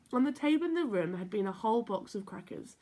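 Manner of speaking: ordinary delivery, nothing marked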